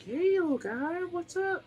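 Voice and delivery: put-on high-pitched, gentle baby talk; gentle pet talk. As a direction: coo high to a scared little kitten. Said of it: "gently petting and being like".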